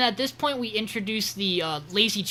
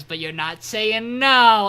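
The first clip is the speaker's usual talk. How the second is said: mock-dramatic retort, swelling louder on the last word; drawn-out retort; 4 semitones higher, 10 dB louder, slower than usual. Podcaster acting out Eiji's comeback to Rin, loud and drawn out. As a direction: build to a loud, stretched NO.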